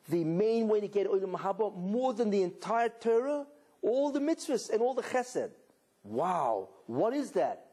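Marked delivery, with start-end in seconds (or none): none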